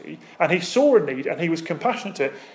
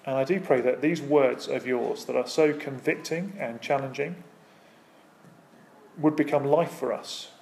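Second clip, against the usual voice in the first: closing prayer; 3 semitones lower than usual. Acted. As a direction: pray reverently over the congregation.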